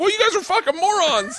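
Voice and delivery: very high-pitched retold insult; mock exasperated comeback. Actors retorting to the writer, mock exasperation.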